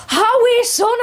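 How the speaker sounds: high, loud Geordie cheer; screaming at the TV. Retold or imitated. Carer screaming at the telly with Ethel, loud scream. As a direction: scream the horse past the post. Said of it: "I'm screaming at the T V".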